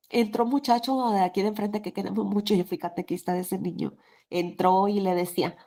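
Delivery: ordinary talk, nothing marked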